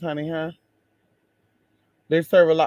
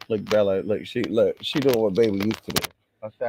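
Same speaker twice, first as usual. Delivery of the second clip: usual speaking voice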